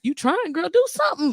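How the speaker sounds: high sassy scold; sassy push. Real, not acted.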